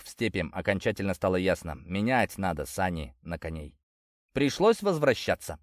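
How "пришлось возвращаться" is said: usual speaking voice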